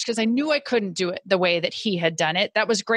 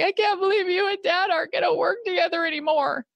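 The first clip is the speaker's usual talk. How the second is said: a higher, lightly voiced child's plea; imitating crying son; 9 semitones higher, faster than usual. Son on her lap pleading, lightly imitated tearful child. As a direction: echo her son's tearful words without real sobs. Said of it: "My youngest son crying on my lap going".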